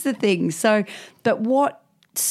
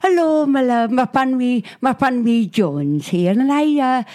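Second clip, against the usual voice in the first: mock Welsh old lady; 6 semitones higher than usual. Pensioner phoning about lost teeth, as a mock Welsh old lady. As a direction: play a fussy elderly Welsh caller.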